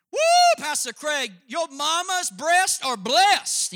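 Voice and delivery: a high, loud, slow shout from the crowd; mock heckler yell. Church member yelling at the preacher, high mock shout. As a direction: holler it out like a heckler in the pews. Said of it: "yelled at the preacher".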